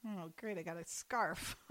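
soft, low dry sarcasm; sarcastic. Retold or imitated. Disappointed boyfriend mocking his gift, low and soft sarcasm. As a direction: say it with a deadpan eye-roll.

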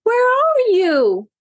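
high, drawn-out worried call; frantic call. A narrator's reading. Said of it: "looking around frantically".

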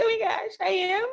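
high, startled "oh my gosh"; shocked surprise. Retold or imitated. Mom startled by her son's praise, high and amazed. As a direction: pitch jumps up in disbelief.

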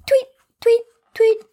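high chirpy birdsong tweets; bird tweet. A narrator's reading. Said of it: "the blue bird started singing".

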